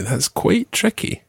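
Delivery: ordinary talk, nothing marked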